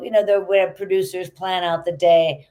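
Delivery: usual speaking voice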